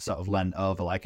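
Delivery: usual speaking voice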